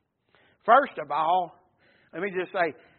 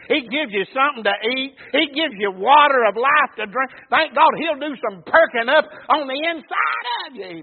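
raised pulpit cadence, building; fervent preaching; 5 semitones higher, 9 dB louder than usual. Real, not acted. Preacher tells congregation what Jesus gives, building fervent preaching. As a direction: preach it with mounting force.

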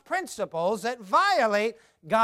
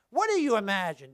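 normal talking voice

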